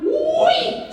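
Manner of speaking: comic high-pitched outburst; mock yell. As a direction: yelp it way up high, gleefully silly. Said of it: "we both yell out".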